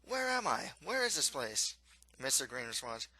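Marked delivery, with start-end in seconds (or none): 0.0-1.8 high-pitched, bewildered questions; startled storybook prince. A narrator's reading. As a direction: wake up confused, voice pitched high. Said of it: "he is startled by his unfamiliar surroundings and asks".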